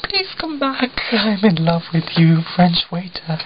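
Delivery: high, hammed-up swooning wail; mock lovesick plea. Smitten customer to the departing French waiter, mock lovesick plea. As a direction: plead in an exaggerated high voice, as if besotted.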